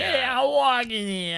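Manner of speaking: put-on high, slow, overdone Queens accent; mock Queens accent. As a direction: shout back like a New York cabbie. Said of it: "a good Queens accent".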